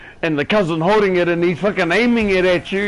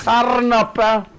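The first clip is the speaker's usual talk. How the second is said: tough cousin line; 5 semitones higher than usual. Retold or imitated. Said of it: "That was a famous line".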